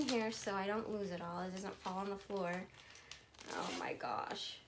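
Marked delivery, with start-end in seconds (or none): 3.5-4.6 awed whisper. Real, not acted. Overwhelmed by a gift.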